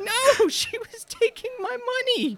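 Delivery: very high sobbing whine; high whiny crying man. Acted. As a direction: blubber like a hurt kid. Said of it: "he's just kind of sobbing".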